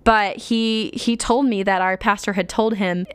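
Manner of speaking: usual speaking voice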